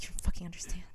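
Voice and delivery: harsh whisper, low. Retold or imitated. Man in the romance book, whispering to her. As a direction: hiss it under your breath. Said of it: "he's like".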